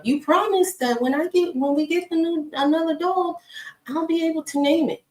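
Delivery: put-on high childlike pleading voice; high kid voice reminding mom of a promise; imitating daughter. Daughter voiced in retold story.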